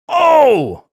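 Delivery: loud, high-pitched drawn-out "oh"; exasperated high wail; exasperated groan. Real, not acted. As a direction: let out a big wailing "oh". Exasperated reaction to rant.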